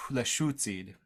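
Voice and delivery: Northern dialect accent with a clear "oo" vowel; Northern Lushootseed accent. Shown as a demonstration. Teacher demonstrating the other dialect, Northern accent. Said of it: "gonna do a Northern accent right now".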